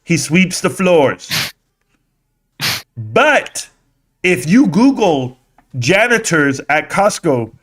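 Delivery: mock the slow speaker's cadence; slow mocking retelling. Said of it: "he said it a lot slower than that".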